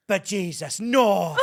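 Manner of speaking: loud, high exasperated Irish yell; mock Irish shout. Paul Mescal yelling at Americans in an Irish bar, loud and high. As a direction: bellow it like Paul Mescal losing patience. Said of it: "Paul Mescal goes".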